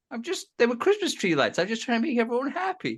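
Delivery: high-pitched cartoon dad imitation; Peter Griffin. Cartoon dad answering the ranting actor, high mock pleading. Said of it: "They just have Peter Griffin going in".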